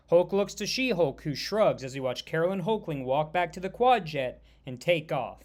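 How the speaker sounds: usual speaking voice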